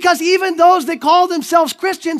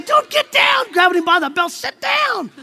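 much higher, frantic hold-back; panicky high yell; 6 semitones higher than usual. Acted. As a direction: grab him and yelp. Disciples yanking Peter back.

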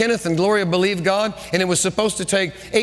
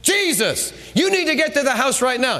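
high-pitched impatient demand; hammed-up frantic insistence; acted fit; 7 semitones higher than usual. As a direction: throw a mock fit, urging him to hurry. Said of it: "he could have thrown a fit".

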